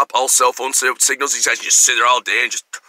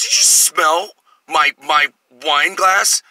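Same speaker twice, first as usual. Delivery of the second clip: slow, drawn-out accusing question; suspicious slow question; much slower than usual. Retold or imitated. Customer slowly asking the waiter about his glass, drawn out. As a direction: draw it out like you caught him. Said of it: "he looked at me and goes".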